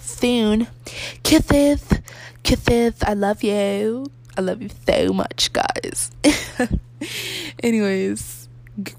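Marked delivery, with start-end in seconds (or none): 1.2-3.0 put-on loud, lisped baby talk; playful lisp. 6.2-7.6 high bubbly giggle. Real, not acted. High laugh after sign-off.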